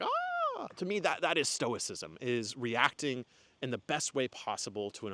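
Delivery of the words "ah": much higher, a quick squeaky yelp; a silent panic made audible, high mock scream; mock inner scream. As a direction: let out a tiny panicked inner shriek. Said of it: "inside I was like".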